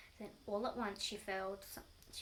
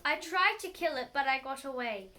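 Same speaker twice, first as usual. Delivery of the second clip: hammed-up alarm; 2 semitones higher, 13 dB louder than usual. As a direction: blare it to frighten her more. Husband scaring wife with story.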